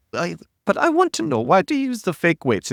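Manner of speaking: very high, timid imitation voice; Anatoly. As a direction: mimic someone nervous and scared in a squeaky-high pitch. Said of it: "this high accent and seem very nervous and scared and timid".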